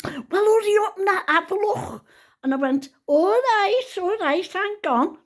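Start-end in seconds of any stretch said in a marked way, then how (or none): 0.0-2.1 high-pitched, impatient prodding; impatient urging. Retold or imitated. Impatient daughter-in-law urging her. 3.1-5.2 high-pitched "give me a second" protest; exasperated. Retold or imitated. Answering daughter-in-law's impatient urging.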